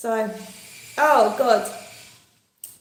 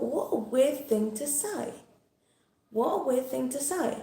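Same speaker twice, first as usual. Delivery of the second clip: puzzled aside; 3 semitones higher, 6 dB quieter than usual. Retold or imitated. Customer thinking about the shop assistant's remark, puzzled and hushed. Her puzzled inner thought, retold.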